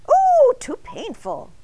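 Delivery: high, slow squeak of a tiny donut; squeaky donut voice. A narrator's reading. Arnie the donut refusing Mister Bing, squeaky and high. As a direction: Arnie wincing at the pincushion idea, high and slow.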